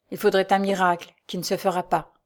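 ordinary delivery

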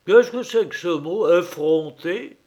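a touch of indignant shouting; angry outcry. Acted. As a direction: bark the insult back, offended. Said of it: "s'écrie l'homme aux plumes de paon, en colère". (cri légèrement indigné)

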